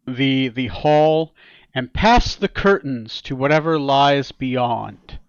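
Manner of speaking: ordinary delivery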